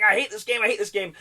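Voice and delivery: mock anger. Reviewer retells his buddy's angry rant, mock anger. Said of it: "He was so angry".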